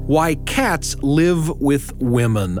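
ordinary delivery

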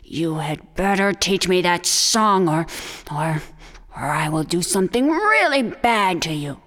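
low, menacing coyote voice; low coyote voice with growing threat; threatening coyote. Acted. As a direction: threaten the turtle, low and dark.

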